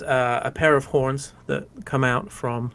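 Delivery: ordinary talk, nothing marked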